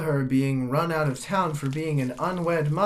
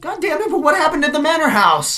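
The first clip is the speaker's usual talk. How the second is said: much higher, louder, fast; loud exasperated yell; frustrated shout; 11 semitones higher, 9 dB louder, faster than usual. Acted. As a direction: snap after being stonewalled. Visitor loses patience.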